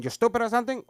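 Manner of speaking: a loud mock scolding from grandfather; mock grandfather yell. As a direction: bark it at the kid like an annoyed grandpa.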